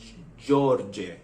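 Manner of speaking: "J" as juh, then "George" as JOR-jeh; Serbian J sound. Shown as a demonstration. Learner demonstrating the letter's pronunciation, Serbian J sound. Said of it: "you made the pronunciation J".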